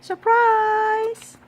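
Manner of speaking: high, loud gleeful shout; shouted surprise. A narrator's reading. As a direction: yell it all together. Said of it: "all the ladies shouted".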